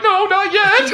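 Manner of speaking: imitating a friend who is half laughing, half crying; laughing near tears. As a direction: squeak it out while cracking up.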